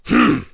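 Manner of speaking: loud, high gruff grunt; a dismissive hmph. A narrator's reading. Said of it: "grunted Mister Grumpy".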